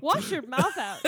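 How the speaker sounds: playful motherly reprimand; scolding fifties mom. Pretend. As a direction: tell off a child, hammed up. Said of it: "like I was a nineteen fifties mom".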